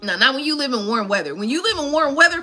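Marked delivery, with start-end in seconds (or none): none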